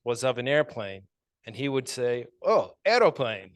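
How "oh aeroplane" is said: put-on little brother's Polish-accented "aeroplane", a bit louder. Brother naming a picture at school, Polish accent. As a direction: imitate a child saying "aeroplane" with a Polish accent. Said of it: "in this Polish accent from my grandmother".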